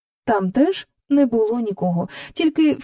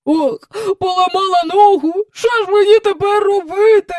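high loud wailing, almost crying; booming lament; 11 semitones higher, 5 dB louder than usual. A narrator's reading. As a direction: boom the lament like a drum. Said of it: "раз у раз бесувала Елін".

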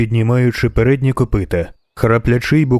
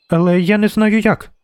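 put-on high-pitched childish protest; little girl; 9 semitones higher than usual. A narrator's reading. Girl stuck up a tree complains, high child's voice. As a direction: protest in a small girl's high voice.

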